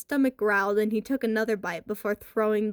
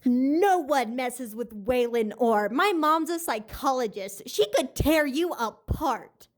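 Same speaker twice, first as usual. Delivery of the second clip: menacing; 3 semitones higher than usual. A narrator's reading. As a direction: lean in and threaten him. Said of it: "a rage in his eyes".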